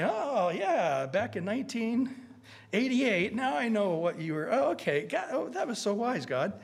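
light up as it finally makes sense; a pretend believer's eager discovery; excited realization. Imagined relief at heaven's archives.

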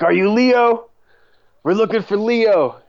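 imitating a shady stranger asking for Leo; mock gangster. Gangsters approach, quoted by teller.

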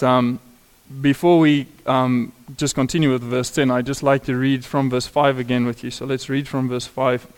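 ordinary delivery

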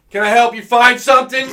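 high, loud sudden voice in the ear; high, loud store clerk popping up behind someone; quoted stranger. Retold or imitated. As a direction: pop up right at his ear with a high, loud offer of help. Guy sneaks up, speaks in ear.

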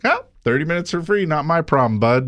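high, dismissive brush-off; smug customer. Retold or imitated. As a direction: sneer at him and shut him down. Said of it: "the guy's like".

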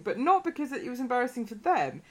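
ordinary talk

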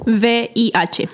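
V I H spelled slowly, letter by letter. Shown as a demonstration. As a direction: say V I H slowly, each letter apart. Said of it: "say it slower".

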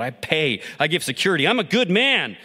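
building to a high, loud outburst; a man storming out, defending himself aloud; blasting defiance. Acted. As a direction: insist on your own worth, rising. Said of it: "He blasted to himself".

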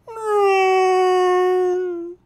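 long, loud, high whale moan; imitated whale call. Whale answers the bartender in a joke, loud whale call. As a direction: wail like a whale singing underwater. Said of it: "the whale goes to the bar and he goes".

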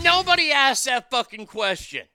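loud, furious snap at the interviewer; indignant loud shout; angry outburst. Real, not acted. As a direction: let the anger burst out loud. Angry rant about interview.

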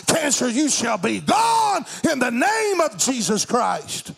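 forceful faith declaration, building to a cry; preaching prayer command. Real, not acted. Preacher rebukes cancer in patients, forceful building declaration. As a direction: command it with drawn-out, driving emphasis.

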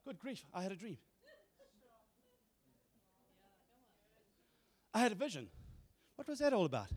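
very soft, puzzled just-woke-up voice; groggy waking. Acted. Preacher acts waking up from a dream, hushed and puzzled. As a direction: murmur it as if just awake.

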